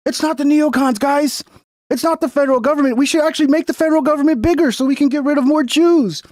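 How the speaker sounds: high, sneering parody of him; mock inner message. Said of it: "this is his inner message".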